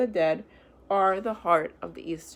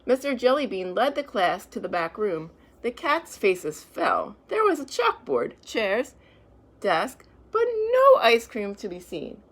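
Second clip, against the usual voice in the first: lively, louder storybook reading; reading to children; 3 semitones higher, 6 dB louder than usual. A narrator's reading. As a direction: read it out brightly to a class. Reads picture book to children.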